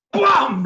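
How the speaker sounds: loud, high "¡pum!" slam; portazo imitado. Retold or imitated. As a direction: burst out a door-slam noise. Imita la puerta que se abre de golpe. (golpe de puerta imitado, fuerte y agudo)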